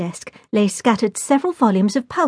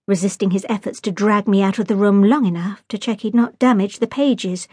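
usual speaking voice

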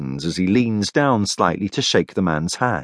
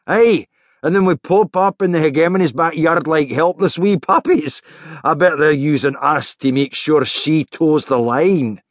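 higher-pitched Scottish character voice; Rory, wry banter; 6 semitones higher than usual. Acted. Rory mocks the hegemony to his comrades, wry banter.

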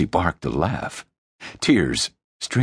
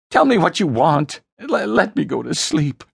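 high desperate begging; anguished, pleading whine; voice cracking; 12 semitones higher, 3 dB louder than usual. Acted. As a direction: stammer out a high-pitched plea. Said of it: "His voice cracked".

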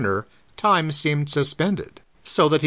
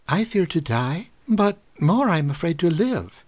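put-on 1 foreign girl; 3 semitones higher than usual. A narrator's reading. Girl voiced in foreign accent.

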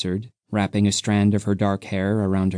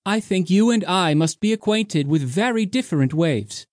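higher, a touch of anger; higher voiced young woman, mildly cross; 8 semitones higher than usual. A narrator's reading. Undina answers with anger.

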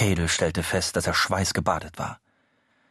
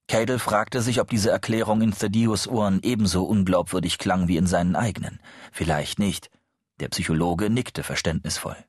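ordinary delivery, nothing marked

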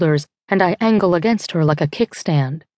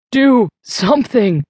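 slow, higher pleading yell; uneven desperate shout; 5 semitones higher than usual. Acted. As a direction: break apart on the demand. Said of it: "Rusty's voice goes uneven".

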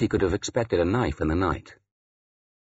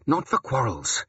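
higher-pitched firm admonishment; insistent; 5 semitones higher than usual. A narrator's reading. Priest cutting off a quarrel at prayer, insistent. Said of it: "he insisted".

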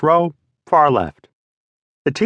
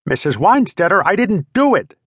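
put-on pleading kid; 8 semitones higher than usual. Boy protesting to teacher.

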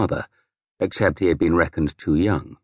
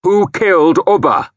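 loud, much higher furious shout; 10 semitones higher, 6 dB louder than usual. A narrator's reading. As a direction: hurl the question at him. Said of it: "I shouted at him".

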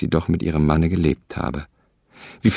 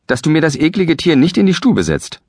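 much higher and louder, scolding; angry call; 10 semitones higher, 4 dB louder than usual. Acted. Wife scolds her husband at the door, loud and high. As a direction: shout him down from the room. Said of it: "rief ihm die Frau entgegen".

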